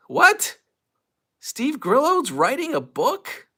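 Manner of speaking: can't believe what you read; shocked disbelief. Real, not acted. Stunned by the news.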